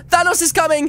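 ordinary talk, nothing marked

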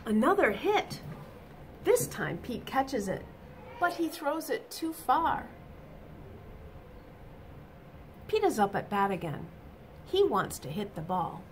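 bright, lively storybook reading; reading to children. A narrator's reading. Teacher reading a picture book to children, animated. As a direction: read it out to the little ones with energy.

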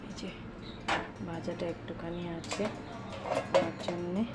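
soft hushed talk, daughter asleep in the next room; speaking softly. Real, not acted. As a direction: keep it low and quiet, almost whispering. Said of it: "এখন আমি আস্তে আস্তে কথা বলছি".

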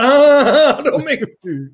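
laughing through the pain, begging him to stop; wheezing laugh, ah don't make me; laughing with broken ribs. Retold or imitated. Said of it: "he's going".

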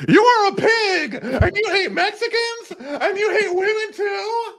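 very high, slow mock shrieking rant; shrill parody yell, slow and high; mocking Kelly Dodd rant. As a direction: screech it out as a parody. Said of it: "the shit that she pulled".

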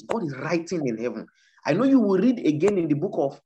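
usual speaking voice

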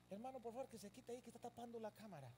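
soft, hurried aside; imitated discreet request. Server asking pastor to move from the camera, softly imitated.

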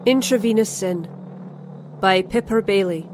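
usual speaking voice

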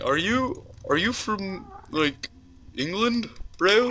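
high-pitched mock commenter voice; imitating commenters. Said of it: "ten comments saying".

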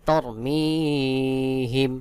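তরমিহিম with a long-held মি; slow recited তরমিহিম, drawn out on মি; elongated pronunciation. Shown as a demonstration. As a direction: stretch the ee in the middle of তরমিহিম, then close on him. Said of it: "মিম ইয়া জের মি এক আলিফ টান".

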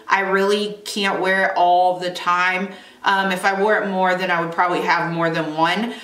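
slightly altered teeth articulation; speaking with grill on teeth. Real, not acted. Seen 2: Metal grill looks visible on her lower teeth while she speaks.

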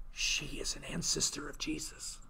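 whispered aside, soft and secret; conspiratorial whisper. Acted. Teacher whispering the scandalous family secret to the class. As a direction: lean in and whisper it like juicy gossip. Revealing Rahab's scandalous descendant.

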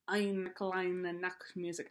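a quiet, slow go at a thick German accent; mock thick German accent. Shown as a demonstration. Teacher to violin students, trying a thick German accent. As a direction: Eine kleine Nachtmusik in a hammed-up German accent. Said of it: "say it in a thick German accent".

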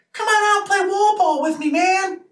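high-pitched odd voice inviting a friend to play; John's strange voice. Retold or imitated. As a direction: chirp the invite in his weird high voice. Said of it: "And that kind of voice".

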